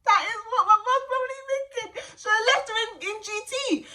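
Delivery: high, laughing words; giggling while picturing mum. Real, not acted. Laughing about mum's thoughts.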